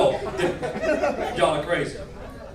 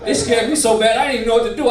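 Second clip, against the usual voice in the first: ordinary talk, nothing marked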